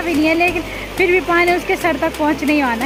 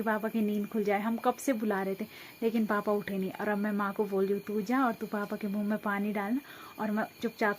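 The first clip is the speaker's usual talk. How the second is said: much softer, hushed so papa keeps sleeping; soft conspiratorial hush; 4 semitones lower, 9 dB quieter than usual. Real, not acted. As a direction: talk low beside a sleeping man. Papa asleep, must not wake.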